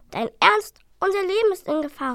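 high, loud disbelief; indignant. Acted. Dragon scolding his brother, indignant and high. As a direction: scold your brother for thinking of food.

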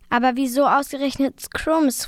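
ordinary delivery, nothing marked

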